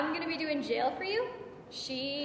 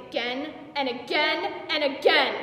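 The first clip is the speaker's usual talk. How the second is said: louder on each repeat; building frenzy; 3 semitones higher, 6 dB louder than usual. Acted. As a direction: drive each "again" harder, like another blow. Relives repeated blows, building.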